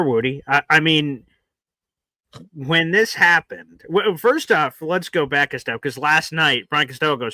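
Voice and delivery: ordinary talk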